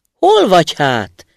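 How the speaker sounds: loud, slow startled shout; astonished call. A narrator's reading. Student calls out, astonished, searching for the voice: loud startled shout. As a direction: call out amazed into the tree. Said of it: "kiáltott a diák elképedve".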